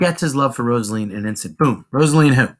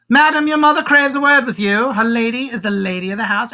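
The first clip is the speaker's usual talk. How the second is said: high-pitched, loud-starting nurse voice; fretful nurse; 8 semitones higher, 3 dB louder than usual. Acted. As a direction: call the young lady away in a high character voice. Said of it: "now the nurse sees this".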